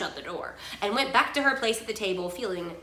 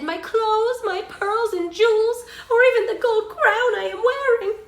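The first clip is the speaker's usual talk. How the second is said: high, loud princess voice; hammed-up princess offering everything; eager princess; 13 semitones higher, 8 dB louder than usual. A narrator's reading. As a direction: plead with rising urgency, high and loud. Narrator voices pleading princess.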